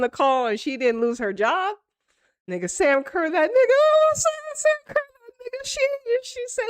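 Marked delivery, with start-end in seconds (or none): 3.3-6.6 high-pitched hyped gushing; overjoyed, voice shooting way up; excited praise. Real, not acted. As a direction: squeal it out in admiration.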